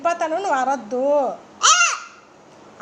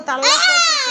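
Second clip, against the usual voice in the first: crying wail; 9 semitones higher, 3 dB louder than usual. Real, not acted. Crying complaint.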